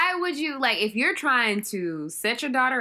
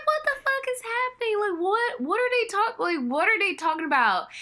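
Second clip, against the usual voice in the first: high, incredulous what is happening; baffled; 8 semitones higher than usual. Retold or imitated. Host recalling her confusion watching the film, high incredulous. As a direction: disbelief pitched up. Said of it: "I was like".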